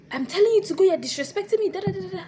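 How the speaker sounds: high, loud mock telling-off; imitated scolding. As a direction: mimic an outraged lecturer ranting.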